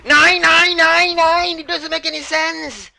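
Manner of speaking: very high, loud meltdown; shrieked gamer tantrum; rage screaming. Real, not acted. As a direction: scream in outraged disbelief.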